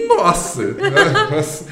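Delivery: loud laughing exclamation. Real, not acted. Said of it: "E aí deu tremedeira?".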